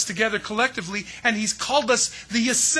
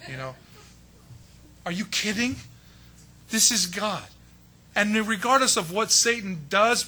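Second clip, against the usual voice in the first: ordinary talk, nothing marked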